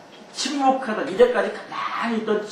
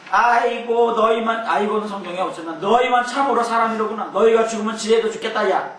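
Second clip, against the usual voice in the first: louder, higher, with a sarcastic sneer; sarcastic retort; 4 semitones higher, 4 dB louder than usual. Acted. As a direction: throw it back at them with scorn. Job retorts to friends sarcastically. (비꼬듯 큰 소리로 받아치며)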